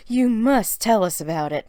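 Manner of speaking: Mary urging her sister to share; insisting. A narrator's reading. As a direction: press her to tell the story. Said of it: "Mary insisted".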